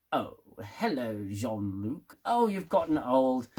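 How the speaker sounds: bad Q impression. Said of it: "my Q impression is shit".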